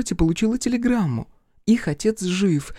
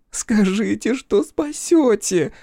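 desperate plea; 5 semitones higher, much slower than usual. Acted. Fiona pleads with Crow.

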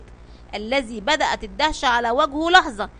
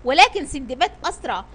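ordinary delivery, nothing marked

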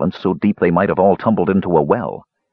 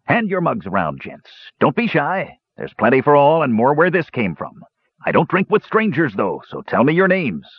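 hearty, higher dwarf voice; a proud dwarf praising his mushroom brew; jolly dwarf; 6 semitones higher than usual. A narrator's reading. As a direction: play the genial dwarf host. Held dwarf voice for Dugan.

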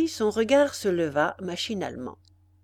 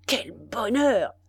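delighted cry; 3 semitones higher, slower than usual. Acted. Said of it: "il poussait un cri d'admiration".

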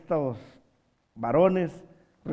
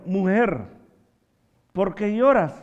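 raised, louder angel's question; loud quoted address, pitched up; proclaimed question; 4 semitones higher, 7 dB louder than usual. Retold or imitated. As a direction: project it as a preacher quoting an angel. Preacher voices angel's question.

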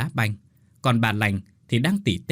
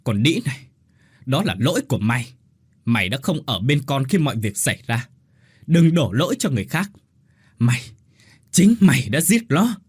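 roaring rage; 3 semitones higher, 5 dB louder than usual. A narrator's reading. Husband grabs wife's collar, furious roar. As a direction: roar the blame in her face. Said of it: "gầm lên".

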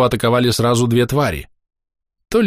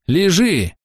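angry bark; 9 semitones higher than usual. A narrator's reading. Healer barking at the injured Andrey, angry. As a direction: snap a sharp high command. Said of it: "сердито рявкнула Шлейла".

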